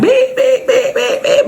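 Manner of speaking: high piercing beep imitation; imitating a beeping smoke detector; smoke alarm beeps. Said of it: "the smoke alarms was going off, like".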